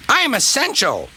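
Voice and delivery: high, self-important brake van. A narrator's reading. Brake van answering angry Donald, high and self-important. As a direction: insist on it in a very high voice. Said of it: "said the brake van".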